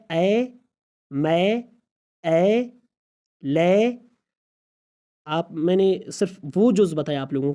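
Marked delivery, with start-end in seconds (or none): 0.1-3.9 اے، میں، اے، لے drawn out on "ae", end consonants dropped; isolated "ae" sound. Shown as a demonstration. Teacher isolating the vowel, اے، میں، اے، لے long and separate. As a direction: stretch each اے، میں، اے، لے with a rising tone. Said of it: "کس طرح اے کا معنی ہے".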